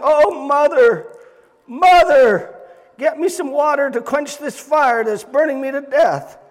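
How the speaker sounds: high, loud pleading for water; desperate crying out. Retold or imitated. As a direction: beg mother in torment.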